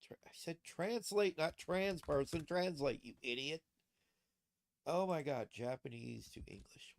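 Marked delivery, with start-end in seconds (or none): none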